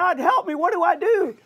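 high, loud panicked pleading; terrified cry for help. Retold or imitated. Man hanging from a cliff limb begs God, high panicked cries. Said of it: "he prays and he says".

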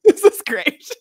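mock-contrite gushing through laughter; apologizing to the book, laughing; laughing apology. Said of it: "and I was like".

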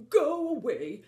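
loud, cranky elephant bellow; grumpy elephant shouting go away. Acted. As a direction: grumble him off the doorstep. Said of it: "I'm grumpy".

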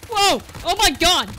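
loud, high-pitched alarm; startled outburst. Real, not acted. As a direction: yelp it out as the enemy jumps you. Startled by enemy with knife.

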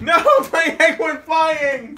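loud high laughing; laughing shout. Real, not acted. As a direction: yell it while laughing. Egg flies away.

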